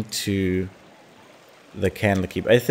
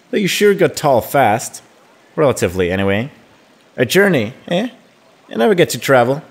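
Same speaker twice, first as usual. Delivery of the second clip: put-on higher, animated voice of a young friend; indignant, pleading friend; 5 semitones higher, 5 dB louder than usual. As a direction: play a teased friend hinting hard to come along. Reads Imoen's wistful reply aloud.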